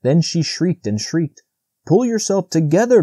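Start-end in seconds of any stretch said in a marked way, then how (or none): 1.9-3.0 higher, urgent begging. A narrator's reading. Bambi begging frightened Faline, urgent. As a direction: plead with her to calm down. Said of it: "Bambi begged".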